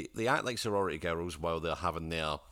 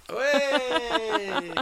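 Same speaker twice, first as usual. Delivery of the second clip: loud, much higher cheer; excited cheer; 8 dB louder than usual. Real, not acted. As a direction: whoop at the pun. Cheering own pun.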